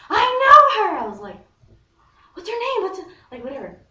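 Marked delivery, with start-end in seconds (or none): 0.1-1.0 sudden exclamation, imitating a friend; excited realisation. 2.3-3.1 very high, loud, urgent question; squealed, frantic asking; eager demanding. Retold or imitated.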